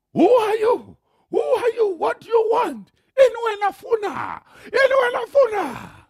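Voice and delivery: high, loud shouted challenge; angry farmer shouting. Retold or imitated. Farmer with gun confronting the trespassing pastor, shouting high.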